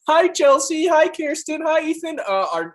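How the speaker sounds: high-pitched playful greeting voice; mock introduction. Joking with friends about introducing everyone by a silly name, high mock-polite voice.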